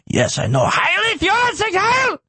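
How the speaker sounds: high put-on voice ending in a mock salute; mock lawyer. Mocking the lawyer's answer to the reporter, high and hammy. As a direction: ham up the lawyer cheering Sieg Heil. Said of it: "He's like".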